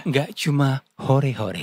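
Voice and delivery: slow, soothing bedtime baritone; sleep call voice. Shown as a demonstration. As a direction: lull them toward sleep, slow and even. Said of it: "Versi sleep call".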